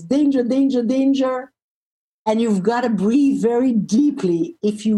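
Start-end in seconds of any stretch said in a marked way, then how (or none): 0.0-1.5 lightly voiced alarm, pitched up; alarmed brain. Reptilian brain reacting to being shouted at, mild alarm. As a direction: repeat it like a mild warning siren. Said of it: "the reptilian brain goes".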